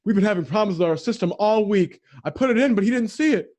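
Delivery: high, excuse-making cashier; mock his flustered excuse. Cashier explaining the system problems to the customer, high and flustered. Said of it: "the guy at the front was like".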